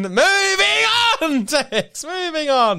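high, stretched and laughing; laughing as she tries to move on from Tex. Real, not acted. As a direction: drag it out through laughter.

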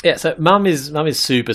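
ordinary delivery

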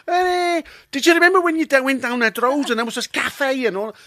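Liverpool friend's high-pitched chatter; Scouse accent. Acted. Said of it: "Mick's a Scouser, and he spoke to me".

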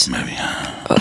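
soft breath-only whisper. Shown as a demonstration. Joking on in the whisper game, whispered. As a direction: keep it hushed and voiceless.

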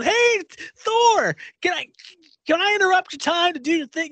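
high-pitched excited fan shout; hammed-up starstruck yell; mock fanboy. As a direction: call out like a fan running up to a star. Acting out fan rushing up.

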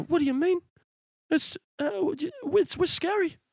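high-pitched, stammering mock Kiwi; flustered high Kiwi caricature; defensive New Zealand accent. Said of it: "so defensive about your accent".

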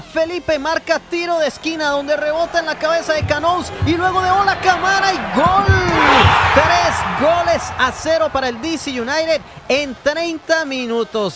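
breakneck goal commentary. Real, not acted. Third goal called out.

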